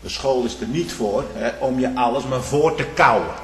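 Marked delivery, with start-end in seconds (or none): none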